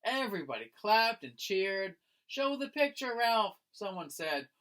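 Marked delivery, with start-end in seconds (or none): 2.2-3.6 high, bright call to Ralph; eager call. Acted. Classmate urging Ralph to show the picture, eager.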